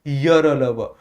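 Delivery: य र ल व said slowly, one at a time; letter demo. Shown as a demonstration. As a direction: spell out य, र, ल, व for students to copy. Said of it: "बोलो जरा".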